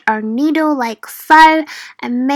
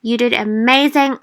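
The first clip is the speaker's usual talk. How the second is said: ordinary delivery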